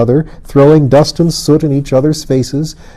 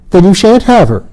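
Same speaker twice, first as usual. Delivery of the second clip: spiteful refusal; 8 semitones higher, 4 dB louder than usual. A narrator's reading. Queen refuses Colin.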